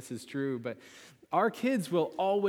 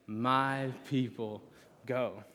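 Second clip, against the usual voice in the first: slow, drawn-out chant; slow, solemn call for the congregation to repeat; slower than usual. Shown as a demonstration. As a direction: lead the room in saying it slowly. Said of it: "Let's say that together".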